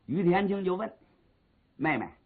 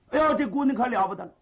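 quick, higher cry of alarm; alarmed exclamation; 6 semitones higher, faster than usual. A narrator's reading. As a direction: blurt it out fast and high. Narrator exclaims at louder crying. (急促惊呼)

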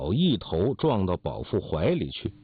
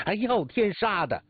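high-pitched quarrelsome tirade; outraged squawking, pitch kept high; yelling in anger; 7 semitones higher than usual. Acted. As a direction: rail as if ready to fight to the death. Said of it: "口里嚷说".

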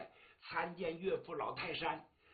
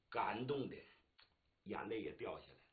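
ordinary delivery, nothing marked

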